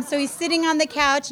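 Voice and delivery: ordinary talk, nothing marked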